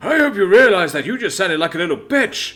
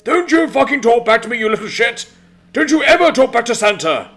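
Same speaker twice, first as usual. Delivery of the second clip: loud, higher furious Santa bark; 9 semitones higher, 8 dB louder than usual. A narrator's reading. Santa barks at Max for talking back, furious. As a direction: bark it like a raging boss. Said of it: "the jolly old elf barked back".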